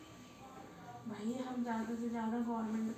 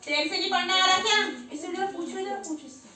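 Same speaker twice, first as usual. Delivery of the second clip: scolding; 7 semitones higher, 8 dB louder than usual. Real, not acted. Mother scolds child who can't read, loud and angry.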